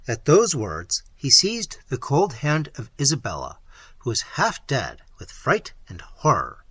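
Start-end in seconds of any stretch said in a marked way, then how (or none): none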